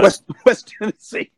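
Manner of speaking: slow, laughing echo. Real, not acted. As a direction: laugh it out in disbelief. Laughing at a ten-hour drive.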